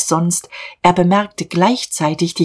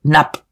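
cut-off, startled half word; faltering; 4 dB louder than usual. A narrator's reading. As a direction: break off mid-name. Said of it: "Diederich stockte".

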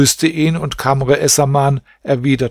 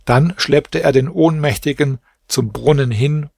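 ordinary delivery, nothing marked

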